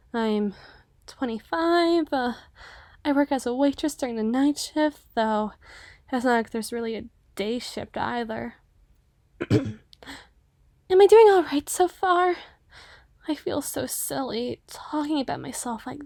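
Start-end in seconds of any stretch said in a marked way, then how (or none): none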